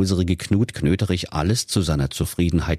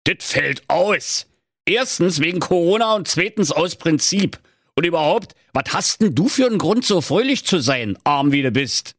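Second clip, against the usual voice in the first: put-on high, indignant old miser ranting; indignant skinflint rant; scornful miser; 10 semitones higher than usual. A narrator's reading. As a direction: scold the spendthrifts. Miser answers nephew.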